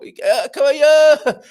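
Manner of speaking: put-on loud, drawn-out drunken call, pitched well up; drunk at pub door. Drunk man calling at the locked pub door, slurred loud bellow. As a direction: bellow it like a drunk wanting his pint.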